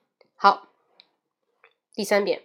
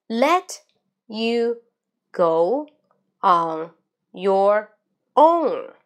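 loud, separated words with long pauses, CAN'T and STOP lifted; slow word-by-word reading; 2 semitones higher, 5 dB louder, much slower than usual. Shown as a demonstration. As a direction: pronounce each word slowly on its own. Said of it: "慢中快". (慢速，一字一顿，大声清楚)

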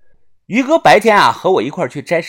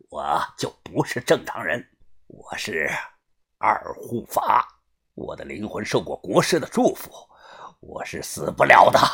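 low, softer villain voice with a laugh in it; quiet, lower gloating laugh through the words; 8 semitones lower, 6 dB quieter than usual. Acted. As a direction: laugh it out low and smug, like an unkillable henchman. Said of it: "口中大笑道".

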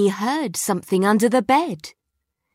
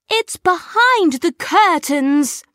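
put-on child's voice; 10 semitones higher, 7 dB louder than usual. A narrator's reading. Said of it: "he said".

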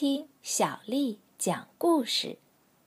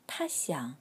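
plain reading voice

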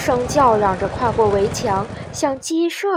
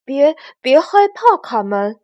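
stammered, high and loud, then dropping; nervous reassurance; 4 semitones higher, 7 dB louder than usual. Acted. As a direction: comfort while scared yourself. Frightened chick stammers reassurance.

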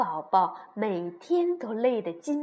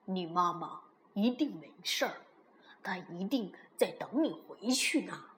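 gentle; 2 semitones lower, 6 dB quieter than usual. A narrator's reading. T-rex comforting child about his mother, gentle. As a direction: reassure softly as the big dinosaur. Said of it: "温柔地说".

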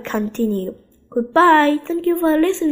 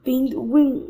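ordinary delivery

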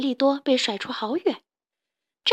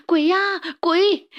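high, loud frightened cry; children panicking at footsteps in the night, screaming; scared shout; 7 semitones higher, 6 dB louder, slower than usual. Acted. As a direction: yelp it out in fright. Kids scared in dark forest.

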